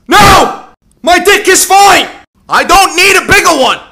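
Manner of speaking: high, loud furious shout at the pop-up; full-throated angry scream. Acted. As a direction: yell back at the ad as if it insulted you. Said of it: "I'm gonna scream really fucking loud".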